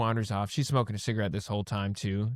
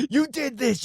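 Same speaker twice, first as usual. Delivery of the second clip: much higher, put-on accusing voice; mock shrill accusation; 12 semitones higher than usual. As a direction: accuse like a cartoon villain. Mock accusing the drunk lady.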